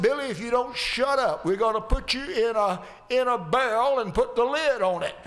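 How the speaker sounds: lightly voiced fed-up villager; a hint of a grumbling neighbour; annoyed neighbour. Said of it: "Somebody said".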